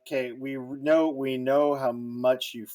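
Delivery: ordinary talk, nothing marked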